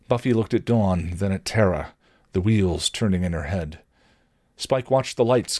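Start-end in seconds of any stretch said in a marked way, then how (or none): none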